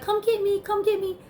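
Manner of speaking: high, light voice of items calling to her; playful high call, as if the shelf items beg to be taken. Pretend. Items on the shelf calling to the shopper, high playful voice.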